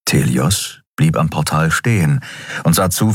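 ordinary delivery, nothing marked

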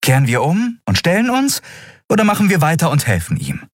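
high, brisk captain's voice; Endriel, posing the choice. Acted. Captain asking the crew to turn back or help, high and decisive.

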